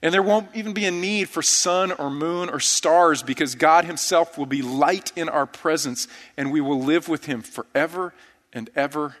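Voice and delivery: ordinary talk, nothing marked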